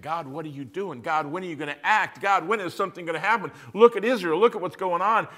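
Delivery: rising, building from a plea to a loud, high, fast outcry; pleading cry to God. Acted. Prophet Habakkuk demands God act, building to a loud cry. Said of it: "Habakkuk who's saying".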